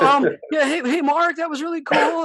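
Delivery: high, loud, squeaky mock-nervous approach; little girl voice. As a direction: imitate yourself going girlish with nerves. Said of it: "like a little freaking girl, dude, my voice".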